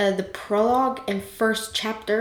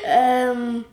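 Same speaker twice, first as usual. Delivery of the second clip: usual speaking voice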